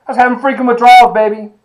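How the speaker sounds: loud, high, fast outburst; fast, loud burst of disbelief at his own withdrawals; worked-up exclamation. Real, not acted. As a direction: blurt it out all fired up. Excited retelling of his caffeine addiction.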